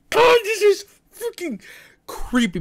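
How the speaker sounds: high-pitched frightened squeal; scared. Real, not acted. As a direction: squeal it out in fear. Overwhelmed by scary game.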